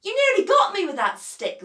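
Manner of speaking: loud and high, calling uphill; annoyed shout. A narrator's reading. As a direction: tell him off loudly. Beaky yells at Lamont.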